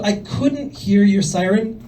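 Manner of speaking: ordinary delivery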